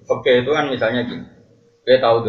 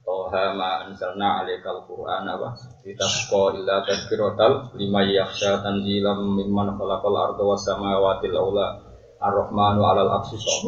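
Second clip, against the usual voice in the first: Quran recitation; 3 semitones lower than usual. Acted. Recites surah Taha.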